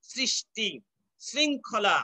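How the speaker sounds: সৃষ্টি and শৃঙ্খলা opening on English "s"; careful sr- onset in সৃষ্টি, শৃঙ্খলা; dental s sound. Shown as a demonstration. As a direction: start শৃঙ্খলা as sring, not shring. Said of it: "দন্ত সই থাকছে".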